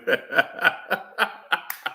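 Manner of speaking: breathy unvoiced run of chuckles; real amused laugh, trailing on. Real, not acted. Laughs at own joke.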